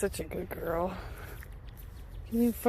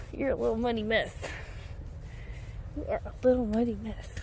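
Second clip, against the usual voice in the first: ordinary talk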